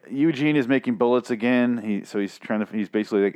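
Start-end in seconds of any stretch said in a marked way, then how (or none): none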